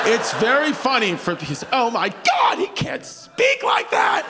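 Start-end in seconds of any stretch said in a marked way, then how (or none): 1.7-4.3 high, drawn-out mock outrage; shocked onlooker. Comedian mimics an appalled audience member, high-pitched shock. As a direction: imitate a scandalized audience member, pitch leaping up.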